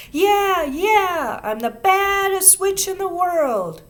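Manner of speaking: high, loud cackling witch boast; witchy shriek. A narrator's reading. Ramona on the playground, shrieking her witch boast. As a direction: shriek it like a wild little witch.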